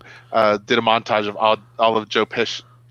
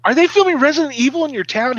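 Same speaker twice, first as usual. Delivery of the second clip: very high, incredulous question; retold excitement; 13 semitones higher, 4 dB louder than usual. Asking friend about the film shoot, re-enacted excitement. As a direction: squeal it up high in disbelief.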